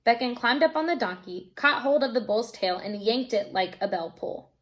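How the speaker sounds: ordinary delivery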